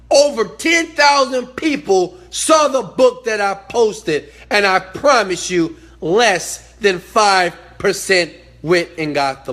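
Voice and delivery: loud, high, slow and hammered out; exasperated emphasis. Real, not acted. Telling how few got the book, exasperated emphasis. Exasperated that followers didn't act.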